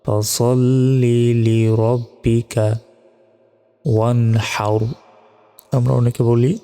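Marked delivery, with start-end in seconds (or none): 0.0-4.8 slow "ফাসল্লি লিরব্বিকা ওয়ানহার" with a break between words; words kept apart. Shown as a demonstration. Teacher shows how to separate the words. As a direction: say each word separately and slowly. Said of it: "শব্দগুলো একটু আলাদাভাবে বলার চেষ্টা".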